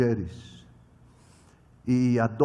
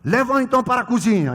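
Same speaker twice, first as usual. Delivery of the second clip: brisk command; 7 semitones higher, 8 dB louder, faster than usual. Retold or imitated. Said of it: "eu falava assim".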